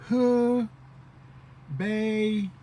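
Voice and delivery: "河 北" stretched and loud, hoo... pay; syllable by syllable. Shown as a demonstration. Teacher breaks word into slow syllables.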